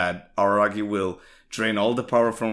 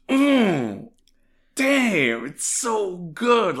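delighted savoring; 12 semitones higher, 6 dB louder, much slower than usual. Real, not acted. Savoring a favorite line.

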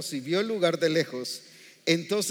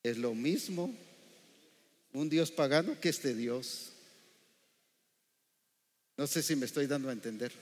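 normal talking voice

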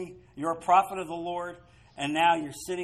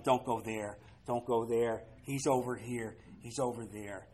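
hushed voice of God; 7 semitones lower, 9 dB quieter than usual. Acted. God warning Elisha, voiced quietly.

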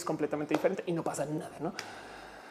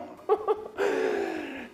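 breathy laugh; 13 semitones higher than usual. Real, not acted. Laughing at her own joke about the folder.

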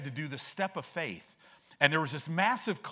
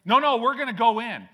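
louder and higher, a defiant no; mock defiant shout; stubborn insistence; 9 semitones higher, 12 dB louder than usual. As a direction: dig your heels in loudly.

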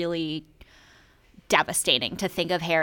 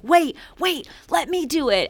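higher, pushy villain voice; mock Voldemort; 9 semitones higher than usual. Voldemort stopping his followers, higher mock-flustered villain. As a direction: play him as a flustered dark lord butting in. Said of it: "And Voldemort is like".

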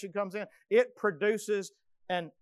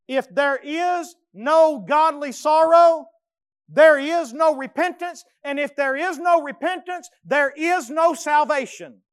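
preaching at full force; 7 semitones higher, 10 dB louder than usual. Real, not acted. Preacher warns the congregation, loud and high. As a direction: hammer the conditions home.

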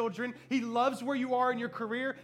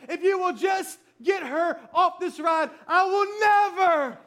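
loud, high pleading vow; desperate bargaining prayer; 8 semitones higher, 10 dB louder than usual. Retold or imitated. Father begging God to get her off, desperate shouting. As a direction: build to a drawn-out shout on "never". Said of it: "must have said this ten times".

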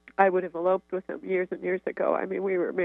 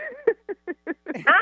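delighted high laugh; 11 semitones higher, 4 dB louder than usual. Real, not acted. Laughs at her own joke.

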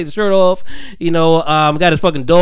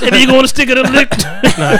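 high, louder laughing lyric; giggling through a flubbed rap lyric; 9 semitones higher, 5 dB louder than usual. Real, not acted. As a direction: blurt the line and crack up.

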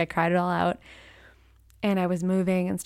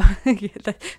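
laugh; 2 semitones higher, 8 dB louder than usual. Real, not acted. Laugh alone on a line.

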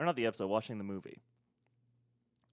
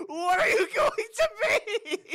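wailing parent; 8 dB louder than usual. Pretend. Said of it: "I'm holding him up to, like".

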